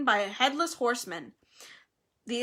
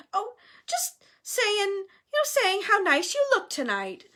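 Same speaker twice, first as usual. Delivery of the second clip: high, falsely sweet backpedalling; stall, then flatter in a raised voice; flustered cover-up; 9 semitones higher than usual. A narrator's reading. Hermione covering up before Myrtle, flustered and sweet.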